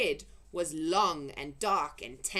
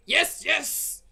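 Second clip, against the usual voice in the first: loud, high insistent boom; booming giant; 8 semitones higher, 8 dB louder than usual. Acted. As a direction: boom it right back. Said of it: "boomed the Blood Bottler".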